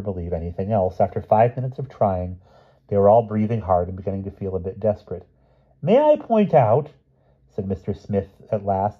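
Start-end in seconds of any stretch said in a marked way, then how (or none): 5.8-6.9 high, slow, formal request; prim interjection. A narrator's reading. Mister Smith breaking into the argument, primly. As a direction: break in with careful politeness.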